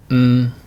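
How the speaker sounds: n hummed and held. Shown as a demonstration. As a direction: sound the n on its own. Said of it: "The two sounds here are".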